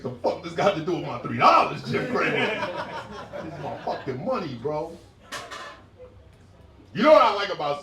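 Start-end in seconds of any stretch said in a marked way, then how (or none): 0.0-4.9 a touch higher and louder complaint; mildly offended viewer; mock indignant. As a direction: talk back at the TV guy.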